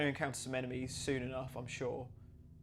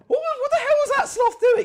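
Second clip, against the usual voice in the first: laughing disbelief; 13 dB louder than usual. Real, not acted. Laughing at weird sloth enemy.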